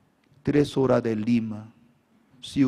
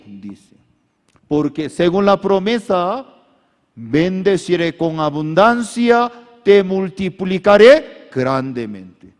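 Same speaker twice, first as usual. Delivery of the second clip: fervent preaching; 6 semitones higher, 9 dB louder than usual. Acted. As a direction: make them repeat it boldly. Preaching, congregation repeats. (declaración enérgica)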